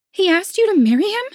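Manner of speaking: shocked, high and loud; gasping shock. A narrator's reading. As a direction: blurt it out in disbelief. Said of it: "Penelope's turn to gasp".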